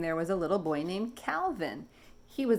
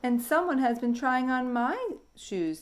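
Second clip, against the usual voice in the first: higher, calm mama dinosaur; 6 semitones higher than usual. Acted. Mama noticing her shoes tried on, calm. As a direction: keep it even and unruffled. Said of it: "said the mama in her calm voice".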